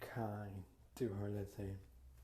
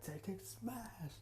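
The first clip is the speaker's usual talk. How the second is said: slow whispered fight noises; whispered sound effects; 8 semitones higher, 9 dB quieter, much slower than usual. Acted. Narrator miming the kick on Gray, slow whisper. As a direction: breathe it out like a hushed action cue.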